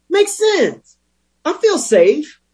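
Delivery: dry mock approval, pitched very high; deadpan irony, squeaky high; sarcastic. Sarcastic comment on own bad logic.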